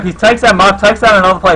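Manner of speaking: quick lift in pitch and volume on the kill; hyped play-by-play burst; excited call. Real, not acted. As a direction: call the frag with a jolt of excitement.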